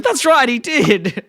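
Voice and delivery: slow, high-pitched mum impression; Marge. Mum backs up her son, slow high naive voice. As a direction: imitate a naive mum earnestly agreeing. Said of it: "And Marge goes".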